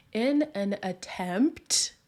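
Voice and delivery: slow, careful, spaced-out words; deliberately slowed down. Real, not acted. Recapper correcting her rushed speech, slow and deliberate. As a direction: rein in the pace and measure each word. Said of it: "I'm speaking real fast today".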